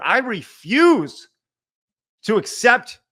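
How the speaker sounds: slow, high, loud, every word spaced; drawn out firm refusal; emphatic refusal. Real, not acted. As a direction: lay it down word by word. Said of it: "I wanna be very clear".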